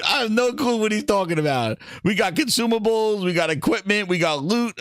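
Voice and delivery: ordinary talk, nothing marked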